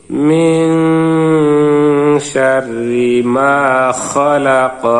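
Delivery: slow, sung and flowing in one breath; joined recitation. Shown as a demonstration. Teacher now reads it joined for students, slow and sung. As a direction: join the syllables into one melodic line. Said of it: "এখন আমরা মিলিয়ে পড়ব".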